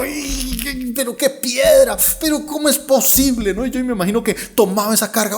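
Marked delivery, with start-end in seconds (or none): 0.0-3.5 high, drawn-out groaning protest; exasperated complaint. Acted. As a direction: strain and complain about a heavy load.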